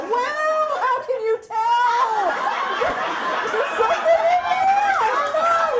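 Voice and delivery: very high, loud mock-panicked wail; panicked outburst. Nervous patient wonders how the gynecologist can tell, in hammed-up shrieking panic. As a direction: shriek it in comic alarm. Acted panicked thought at doctor's question.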